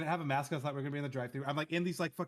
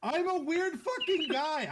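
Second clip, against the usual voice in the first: very high, drawn-out protest; exasperated self-defence; 12 semitones higher, 3 dB louder, slower than usual. Real, not acted. Defending his hoodie to friends, high exasperation. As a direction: protest slowly, voice pitched way up.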